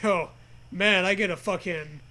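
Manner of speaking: normal talking voice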